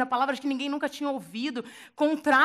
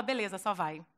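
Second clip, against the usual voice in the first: calm reply; 6 semitones lower, 6 dB quieter than usual. Retold or imitated. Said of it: "Aí Jesus fala".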